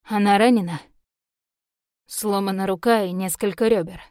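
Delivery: sombre report of injuries; understated gloom; grim confirmation. A narrator's reading. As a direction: confirm it grimly, firm at the start. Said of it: "мрачно подтвердил тот".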